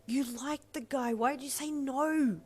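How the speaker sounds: high, slow self-scolding cry; internal scream. A narrator's reading. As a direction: wail at yourself in disbelief. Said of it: "she screamed at herself internally".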